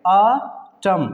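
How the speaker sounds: ऑटम in two separated syllables, ending on M; silent N. Shown as a demonstration. Teacher answers his question, ऑटम split, without N. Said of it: "क्या पढ़ेंगे".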